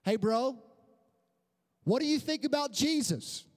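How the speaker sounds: high-pitched acted call; retold awkward shout. Customer calling to departing pizza delivery man, awkward shout. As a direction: call out abruptly, as if after someone leaving. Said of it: "I just shouted".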